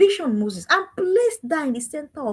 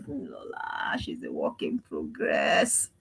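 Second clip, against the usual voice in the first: much lower, softer and slow, resigned calm; 10 dB quieter, much slower than usual. Retold or imitated. Mother calming herself about her daughter Lola, low and slow. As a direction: drop right down and let it go slowly. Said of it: "And I just now say".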